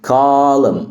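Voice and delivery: "Column" as "col-um", ending on M; silent N. Shown as a demonstration. Teacher repeats for learners, "Column" with silent N. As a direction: leave the N off "Column". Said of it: "ال N بتبقى silent".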